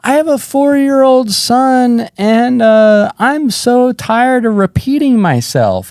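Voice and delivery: high, slow, strained plea; acted desperate confession. Bombing comic confesses to the silent crowd, desperate. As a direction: blurt it slowly in a high, desperate voice.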